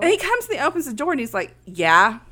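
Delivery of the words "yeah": loud, abrupt mock-aggressive yeah. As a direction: imitate a brusque man answering the door. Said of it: "It's a little aggressive".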